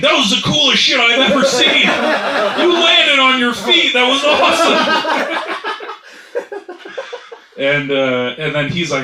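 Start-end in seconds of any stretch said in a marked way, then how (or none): 0.0-5.5 high, loud, thrilled shouting; imitating a bystander bursting with excitement; crazy excited. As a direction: shout it like an awestruck fan. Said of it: "trying to stop himself from saying".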